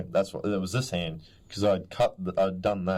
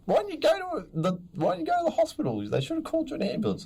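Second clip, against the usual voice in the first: high, fast squeaky mum voice; mock worried mum; faster than usual. Retelling mum scolding about the hospital, high and fast. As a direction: fret it out like an anxious mum, high and quick. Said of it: "Mum looks at him".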